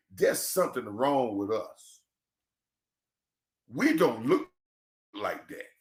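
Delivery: soft, dropped voice, as if admitting a hard truth; quiet imagined quote. Retold or imitated. Host voicing what fans would say, quietly and softly. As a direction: say it low and quietly to yourself. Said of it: "you will say".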